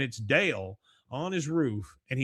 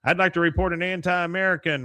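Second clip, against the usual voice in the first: higher, louder official report; tattling informant; 5 semitones higher, 5 dB louder than usual. Retold or imitated. Dale reports his neighbor by phone, higher and louder. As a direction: snitch with relish. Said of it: "He goes".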